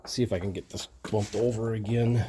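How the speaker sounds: talking around a flashlight in mouth; mouth propped open. Real, not acted. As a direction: speak with a flashlight clamped in teeth. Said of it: "I got a flashlight in my mouth".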